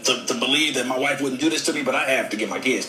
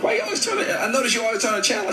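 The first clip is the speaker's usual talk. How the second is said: suspicious husband; 10 semitones higher, faster than usual. Retold or imitated. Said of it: "I'm like".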